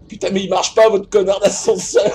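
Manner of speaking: loud sing-song swearing at the lift; half-singing furious curse; frustrated loud cursing. Real, not acted. Cursing the broken elevator.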